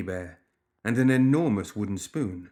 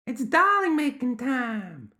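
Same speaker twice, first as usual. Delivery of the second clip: put-on very high, slow, insistent child voice; stubborn high-pitched gremlin kid; firm gremlin child; slower than usual. A narrator's reading. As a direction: insist in a drawn-out little gremlin squeal. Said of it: "the child said again firmly".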